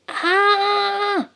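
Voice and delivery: high, long storybook scream. A narrator's reading. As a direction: cry out while falling. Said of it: "schreit Lina".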